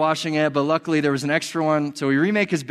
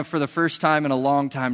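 ordinary talk

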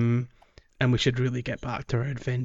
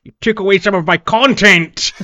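mock outrage; 7 semitones higher, 10 dB louder than usual. Mock complaint about stolen content.

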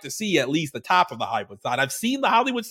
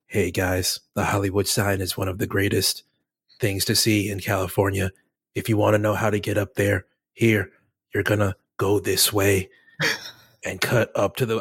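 very low, measured narration voice; low, even mock-narrator delivery; default TikTok narrator; 10 semitones lower than usual. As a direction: do a deadpan Reels voiceover in short phrases with pauses. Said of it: "And then there'll be, like, the".

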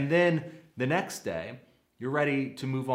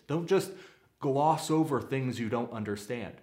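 ordinary talk

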